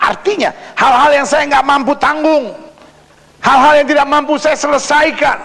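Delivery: high, sustained preacher's voice; preaching emphatically. Acted. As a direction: drive each phrase home from the pulpit. Preacher raises to full force. (berkhotbah dengan nada tinggi dan tegas)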